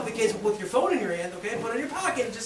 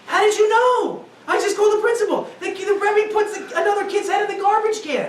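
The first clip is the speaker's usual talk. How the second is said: worked-up mother; 6 semitones higher than usual. Retold or imitated. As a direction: blurt out the shocking news. Mother answering about abusive rebbe.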